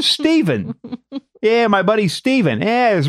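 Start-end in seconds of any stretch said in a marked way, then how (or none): 0.0-0.7 Jersey guy. Acted. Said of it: "like, a Jersey guy".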